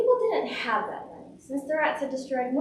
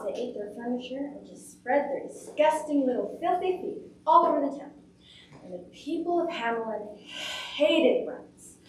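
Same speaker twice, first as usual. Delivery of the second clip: plain reading voice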